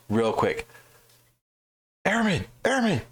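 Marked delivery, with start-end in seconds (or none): 2.1-3.0 high, calling out after someone. Retold or imitated. Officer calling after the airman, high-pitched call. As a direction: call down the street to stop him.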